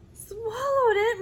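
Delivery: high, loud, slow worried cry; alarmed cry. A narrator's reading. Maria alarmed that Danny swallowed the ring. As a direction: gasp it out in alarm. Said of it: "Maria cried".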